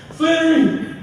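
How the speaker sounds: very high, loud cry for rescue; hammed-up frantic screaming; mock panicked yell. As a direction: yell in comic panic down the phone. Acted panicked phone call for rescue.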